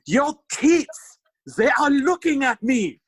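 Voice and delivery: high, loud hammed-up French-accented cry; bad French accent. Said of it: "The first line he says when he sees this is".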